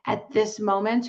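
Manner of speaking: ordinary delivery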